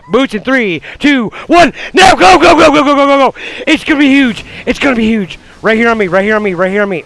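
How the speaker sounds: high urgent yelling; shouted countdown. Real, not acted. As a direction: call the engage at full volume. Countdown into a fight.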